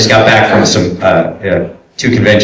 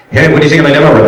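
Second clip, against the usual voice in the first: eager demo-maker; 7 semitones higher, 3 dB louder, much faster than usual. Retold or imitated. Voices hopeful people with demo reels.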